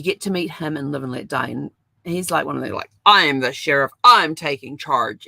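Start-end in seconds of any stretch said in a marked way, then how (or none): 3.1-5.3 mock sheriff authority. Sheriff asserting authority, quoted.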